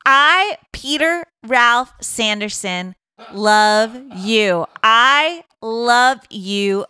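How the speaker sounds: loud, drawn-out proclamation of love; mock grand declaration. Pete declares his love to Drew on the Jumbotron, in a slow, mock grand announcement. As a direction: stretch each word out like a public announcement.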